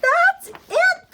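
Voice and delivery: very high, loud exclamation; excited cry. A narrator's reading. Little girl finding the name, excited high cry. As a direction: cry out at a sudden discovery. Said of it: "the little girl cried".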